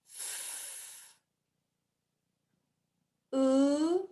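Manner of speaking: "Oo, o, f" split into separate sounds with pauses; sound talking. Shown as a demonstration. Teacher sound-talking a word for the class to blend. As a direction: segment the word slowly, sound by sound. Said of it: "I am going to sound talk a word".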